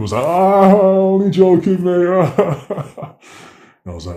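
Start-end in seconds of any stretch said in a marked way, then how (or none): 0.3-2.1 much higher, louder, slow laughing voice; laughing joke. Retold or imitated. Officer laughing after pranking the nervous suspect. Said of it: "he all burst out laughing". 2.1-3.1 loud, high burst of laughter; big laugh. Retold or imitated. Officers laughing at their prank, loud burst. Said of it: "he all burst out laughing".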